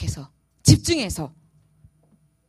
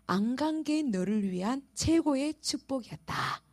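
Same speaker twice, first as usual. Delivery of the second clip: gentle voice of God; 7 dB quieter than usual. Retold or imitated. Voicing God's answer.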